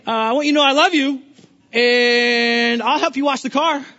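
sugary-sweet friendliness with a barbed edge; mock-kind offer that is really a dig at the neighbor; passive aggressive. To the neighbor about the parked car, passive-aggressive sweetness. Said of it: "You can even go passive aggressive with it".

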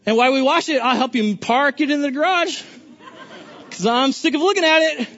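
sugary-sweet friendliness with a barbed edge; mock-kind offer that is really a dig at the neighbor; passive aggressive. To the neighbor about the parked car, passive-aggressive sweetness. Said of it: "You can even go passive aggressive with it".

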